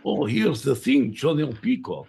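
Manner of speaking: ordinary delivery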